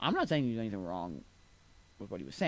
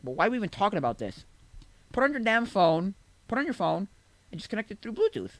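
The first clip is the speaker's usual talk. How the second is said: exasperated, raised-pitch mock advice; dismissive attitude; 6 semitones higher, 3 dB louder than usual. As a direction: imitate someone fed up with the whole question. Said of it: "they had the attitude of".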